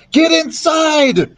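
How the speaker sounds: high, slow drawn-out scream; screaming warning. A narrator's reading. Jack screaming to people up ahead, high drawn-out warning. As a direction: scream it to strangers far ahead. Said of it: "he screamed to the people up ahead".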